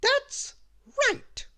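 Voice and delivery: very high, loud cry; high bossy exclamation; Little Miss Bossy. A narrator's reading. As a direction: burst out triumphantly, each word apart. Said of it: "cried Little Miss Bossy".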